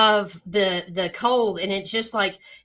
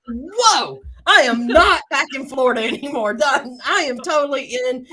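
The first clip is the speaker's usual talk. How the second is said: excited; 5 semitones higher, 5 dB louder than usual. Retold or imitated. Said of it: "I was like".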